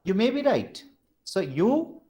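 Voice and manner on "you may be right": YOU MAY BE RIGHT pitched high in an agreeing tune; high arc over MAY, then down toward RIGHT; falling-rising agreement. Shown as a demonstration.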